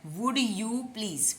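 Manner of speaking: would you please said slowly, would as wood; careful model line; slow pronunciation demo. Shown as a demonstration. Teacher models the phrase for students to repeat. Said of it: "please तो सबको आता है".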